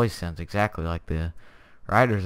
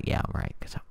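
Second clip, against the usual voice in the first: smug whispered aside; whispering; 8 dB quieter than usual. Real, not acted. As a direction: whisper it smugly to yourself.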